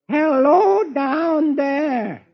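high, long-drawn call. Acted. Beetle calling down the pipe for help, high drawn-out holler. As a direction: stretch each word, calling high down a pipe. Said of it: "I'll crawl down this pipe".

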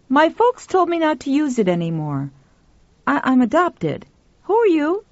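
put-on high-pitched, candid boy's voice; young boy. A narrator's reading. Adopted boy tells the woman about his name, high young voice. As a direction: speak up high like a boy explaining himself.

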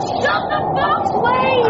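high, frantic order over roaring wind; alarmed shouting; urgent yell. Acted. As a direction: yell to be heard in a gale. Yells over the gale.

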